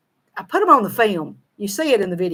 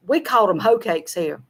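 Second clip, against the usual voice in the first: ordinary delivery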